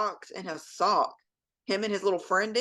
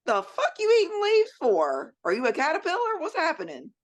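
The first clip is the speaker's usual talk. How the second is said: pitched way up in disbelief; incredulous high-pitched mock outrage; 11 semitones higher than usual. Mom to her son who ate leaves, shocked high-pitched disbelief. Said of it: "And I was like".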